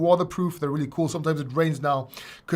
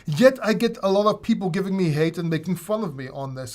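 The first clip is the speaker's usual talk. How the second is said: ordinary delivery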